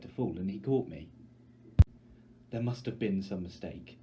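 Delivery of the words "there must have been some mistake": usual speaking voice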